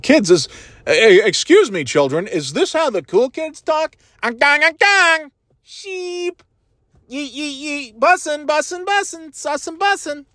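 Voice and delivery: hammed-up dad asking kids if he's cool; mock cool kid. Dad pretending to ask children, in a high mock voice. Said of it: "go up to some children and say that".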